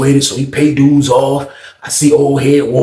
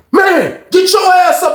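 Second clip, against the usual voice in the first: retold shout; slower than usual. Old head banging on cell door.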